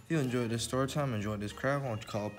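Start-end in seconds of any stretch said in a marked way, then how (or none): none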